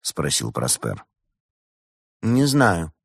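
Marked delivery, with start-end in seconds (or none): 2.2-2.9 offhand, understated shrug; a touch of unconcern; indifferent. A narrator's reading. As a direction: answer as if it hardly matters. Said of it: "равнодушно ответила Валерия".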